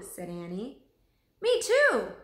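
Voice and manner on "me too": put-on higher, louder eager boy voice; eager echo. A narrator's reading. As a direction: jump in keen to agree.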